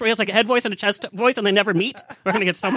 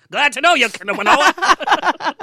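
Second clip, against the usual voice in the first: laughing; 6 semitones higher, 5 dB louder, much slower than usual. Real, not acted. Laughing at the mispronunciation.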